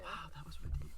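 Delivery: breathy hushed whisper. Real, not acted. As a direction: whisper it like a secret. Mock deep remark after pep talk.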